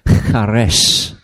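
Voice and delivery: "charesh" with a throaty kh, ending in sh; Hebrew pronunciation. Shown as a demonstration. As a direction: model "charesh" as kha-RESH for learners. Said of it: "you can try and say it".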